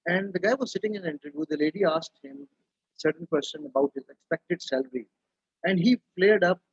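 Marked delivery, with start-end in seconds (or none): none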